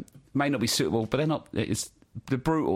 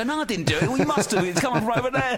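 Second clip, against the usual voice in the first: much higher, mock-innocent crowd voices; crowd members; 11 semitones higher than usual. Audience members blaming each other for the fart, high mock protest. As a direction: squeal the denial like indignant punters. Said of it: "They go".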